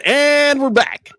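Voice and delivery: high, drawn-out DJ "And we're back"; hammed-up slow radio return; radio host sign-on. Shown as a demonstration. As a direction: announce it slowly like on-air radio. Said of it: "let me try that again".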